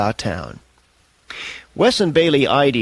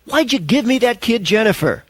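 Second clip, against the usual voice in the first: angry yell; 9 semitones higher than usual. A narrator's reading. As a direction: yell it at the producer. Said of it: "Wes yelled at Bailey".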